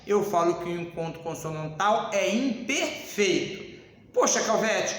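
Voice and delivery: normal talking voice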